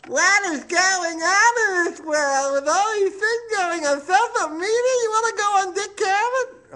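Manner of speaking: camp it up as Truman Capote calling; Truman Capote impression. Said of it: "phoned me one day and said".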